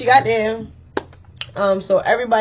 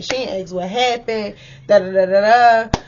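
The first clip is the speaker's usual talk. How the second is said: ordinary talk, nothing marked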